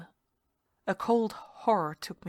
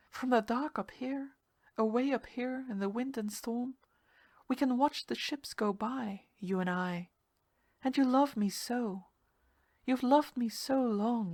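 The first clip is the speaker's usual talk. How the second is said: higher voice, sweet and seductive; higher, tender siren; 4 semitones higher than usual. Acted. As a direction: lure him gently. Seductive sea-devil voice.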